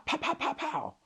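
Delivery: sharp high pops like distant gunfire; quick run of mock gun pops; imitated gunshots. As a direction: mimic rapid shots going off. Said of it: "and I hear pow".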